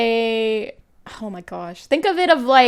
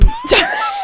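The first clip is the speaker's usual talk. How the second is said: voice crack laugh; 3 dB louder than usual. Real, not acted. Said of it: "I got a voice crack".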